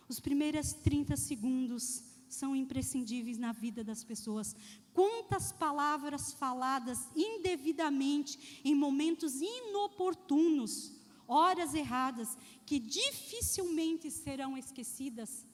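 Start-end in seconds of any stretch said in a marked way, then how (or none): none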